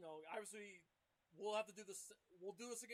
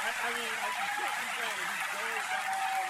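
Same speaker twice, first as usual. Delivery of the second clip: loud emphatic; 4 semitones higher, 17 dB louder than usual. Real, not acted. Defending the big donor.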